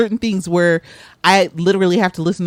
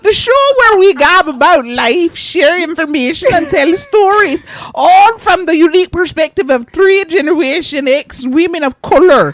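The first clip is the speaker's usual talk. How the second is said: bad British accent, pitched higher and louder; mock British accent; 9 semitones higher, 5 dB louder than usual. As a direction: play a fake British show host. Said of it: "That wasn't even a proper British accent".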